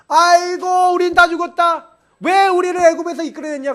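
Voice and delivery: high, loud, wailing cry of despair; wailing lament. Retold or imitated. Israelites wailing after the spies' report, loud lament. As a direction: wail it out like a grieving crowd. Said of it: "통곡을 했어요".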